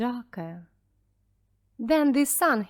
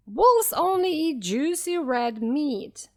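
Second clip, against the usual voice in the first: wolf; 5 semitones higher, 4 dB louder than usual. A narrator's reading. Wolf scorns vegetables.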